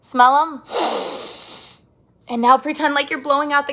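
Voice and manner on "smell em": ordinary talk, nothing marked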